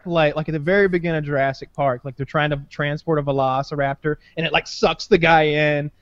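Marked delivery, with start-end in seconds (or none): none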